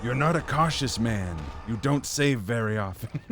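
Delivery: lightly voiced creepy mind reader; a touch of a taunting psychic villain; Psycho Mantis voice. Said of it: "Psycho Mantis voice".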